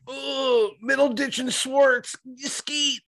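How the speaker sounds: high, slow squeaky mock voice of a comedy fan; mocking impression. Mocking a friend's love of improv, in a high mock squeal. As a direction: squeal it like a giddy superfan. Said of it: "You're like".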